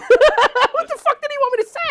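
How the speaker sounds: a very high question broken up by laughter; cracking up while asking it; laughing. Real, not acted.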